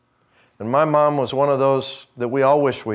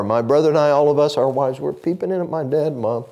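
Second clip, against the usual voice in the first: ordinary delivery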